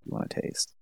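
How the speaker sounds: hushed, voiceless mock temptation; imitated coaxing. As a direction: imitate her coaxing him in a near whisper. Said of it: "she's encouraging him to do it".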